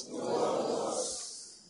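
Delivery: whispered very softly and slowly. Shown as a demonstration. Preacher repeating the church's line back in a slow whisper. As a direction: breathe The word works in a slow hush. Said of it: "Somebody say".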